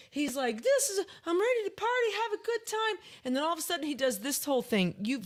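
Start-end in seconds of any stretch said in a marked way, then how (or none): 0.6-3.0 high, louder mock party-goer; hyped party guy. Retelling a drunk fan greeting her, hyped and high-pitched. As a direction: imitate a tipsy guy raring to party. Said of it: "he just came to me and he's like".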